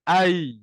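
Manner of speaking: drawn-out held I vowel, loud; vowel I demo. Shown as a demonstration. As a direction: say I stretched with the mouth wide. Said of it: "Sebut huruf alif dulu, hamzah dulu, a, dan baru kita matikan".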